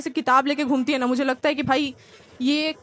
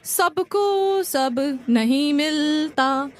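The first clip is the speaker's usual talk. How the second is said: very slow, sage-like mock advice; preachy friend; much slower than usual. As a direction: drag it out like a smug wise friend. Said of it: "क्या बोलते हैं?".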